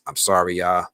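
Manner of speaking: normal talking voice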